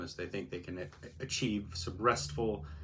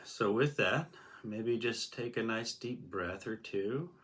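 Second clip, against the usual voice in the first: guided meditation; 2 semitones lower, 3 dB quieter than usual. Acted. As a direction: ease the listener gently into stillness. Begins guiding the meditation.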